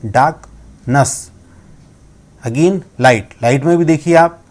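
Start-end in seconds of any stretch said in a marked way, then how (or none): 0.1-1.3 डार्कनेस said slowly, no r, long open "aa"; no r sound. Shown as a demonstration. Teacher models डार्कनेस slowly for students, r dropped. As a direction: stretch डार्कनेस out with a silent r. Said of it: "र sound यहाँ नहीं आता".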